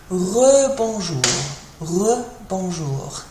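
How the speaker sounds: slow "rebonjour", re as a drawn-out "ruh"; French re sound. Shown as a demonstration. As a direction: stretch the first syllable of "rebonjour" with a French schwa. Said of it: "The re is kind of an e sound".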